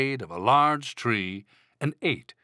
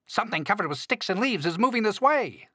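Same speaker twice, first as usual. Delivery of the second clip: high-pitched squirrel warning; excitable storybook squirrel, pitched way up; alarmed squirrel cry; 10 semitones higher, faster than usual. A narrator's reading. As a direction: cry out the danger. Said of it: "cried a squirrel".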